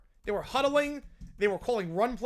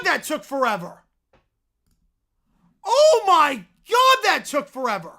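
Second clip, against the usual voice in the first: normal talking voice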